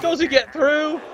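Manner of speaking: real commentator tension building; excited suspense. Real, not acted. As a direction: urge the runner on. Commentator tense over a run.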